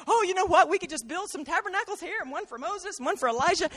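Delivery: chattering Peter. Acted. Peter yapping away.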